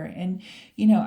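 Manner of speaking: ordinary delivery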